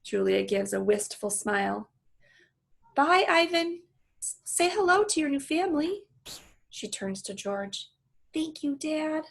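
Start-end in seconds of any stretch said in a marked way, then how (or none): 2.9-6.1 high, wistful farewell; girl voice. Acted. Julia farewell. 8.3-9.2 put-on high, quiet grateful girl; soft girl voice. Julia to George.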